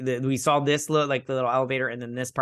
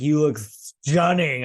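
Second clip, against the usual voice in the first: slow, higher, louder mock swoon; love-struck boy; 4 semitones higher, 5 dB louder, much slower than usual. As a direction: draw it out like an over-smitten boyfriend. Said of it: "this love-struck boy".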